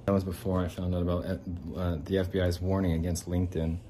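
normal talking voice

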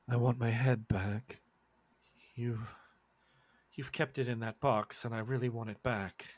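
slightly low, quiet demand; aggrieved rabbit figure. Acted. Game master as rabbit wanting its head back, quiet grievance.

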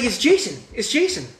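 high-pitched, worked-up insistence; insistent protest. Retold or imitated. Reenacts insisting on the answer.

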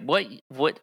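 ordinary talk, nothing marked

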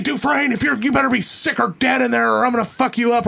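a guard yelling into the cell; mock guard yell. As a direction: bark it like a prison guard. Guards yelling at roll call.

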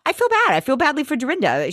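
high, quick mock sympathy; Ramona. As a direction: impersonate a fretful friend. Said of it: "she's like".

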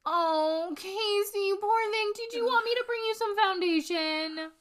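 high, sugary mock sympathy; mock sweet pity. Mocking Casey with syrupy sweetness. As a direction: coo it like fake pity.

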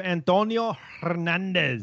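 Hernandez with a rolled, trilled R; rolled tongue. Real, not acted. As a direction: roll the tongue through the R in Hernandez. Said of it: "a little bit of tongue action".

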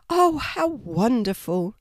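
slow, delighted exclamation; exclaimed. A narrator's reading. Kangaroo delighted to see her friend's baby, slow exclamation. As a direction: marvel at the baby. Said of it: "Sylvia exclaimed".

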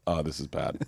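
low, hushed bad-news voice; retold dismay. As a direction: imitate a booker dreading the news. Booker returns with worse news.

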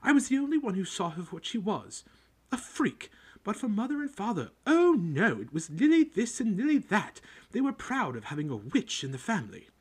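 high, loud, jealous ranting about her sister; spiteful rant. Acted. Aunt venting to Harry about her sister, loud spiteful rant. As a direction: spit out years of resentment. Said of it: "went ranting on".

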